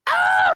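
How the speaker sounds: high piercing scream of pain; agonized scream. Acted. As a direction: shriek like burning monsters. Vampires scream in agony.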